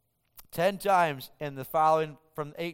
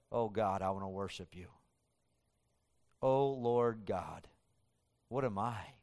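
hushed prayer; 6 semitones lower, 6 dB quieter than usual. Acted. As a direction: pray it barely above a breath. Preacher voices David's humble prayer.

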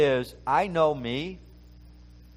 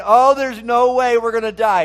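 mock panic; 6 semitones higher, 9 dB louder than usual. Mocking the complaining Israelites.

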